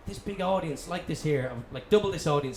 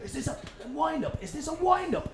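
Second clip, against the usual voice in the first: high-pitched imitation of an angry roar; mock roar; 13 semitones higher than usual. Roy Keane in the interviewer's face, a mock furious roar. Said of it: "starts roaring at me going".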